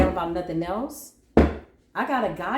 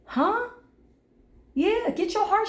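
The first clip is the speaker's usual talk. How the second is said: sassy huh; 12 semitones higher than usual. Real, not acted. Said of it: "rhetorical challenge to viewer".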